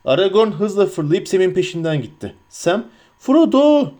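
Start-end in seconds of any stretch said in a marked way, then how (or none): 3.2-3.9 high, loud cry of the name; shouted call. A narrator's reading. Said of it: "diye bağırarak nefes nefese". (tiz ve yüksek sesle bağırarak)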